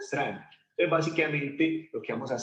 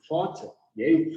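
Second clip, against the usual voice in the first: ordinary talk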